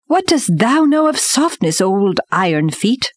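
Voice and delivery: ordinary delivery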